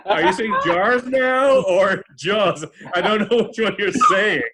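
loud, high, drawn-out retold retort; mock exasperation. Friend teases Jason over his accent, loudly exasperated.

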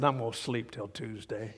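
a hint of sulky cat muttering from under a blanket; grumpy Garfield. Garfield grumbling from under the blanket, mildly low and soft. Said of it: "put the blanket over his head and said".

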